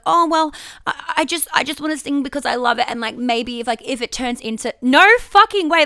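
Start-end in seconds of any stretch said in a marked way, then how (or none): 0.1-2.9 timid apologetic voice. Retold or imitated. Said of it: "they don't have space for me to come in and be like".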